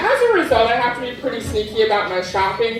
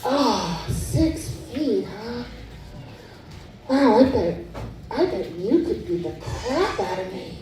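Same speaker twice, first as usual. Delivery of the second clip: hushed creepy admirer; 6 dB quieter than usual. Retold or imitated. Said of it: "creepy man at package store".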